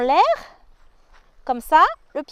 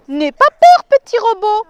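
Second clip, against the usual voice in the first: reassuring; 5 semitones higher, 9 dB louder than usual. Acted. Reassuring the robot.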